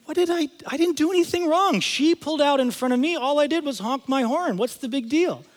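high-pitched defensive self-justifying; acted-out baffled indignation; indignant protest. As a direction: protest your innocence in a high voice. Acts protesting at screaming lady.

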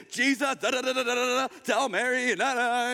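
high, hammed-up worked-up babble; mock a frantic, nagging complaint; agitated. Worked-up Martha nagging Jesus about Mary, mock agitated. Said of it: "She's all agitated".

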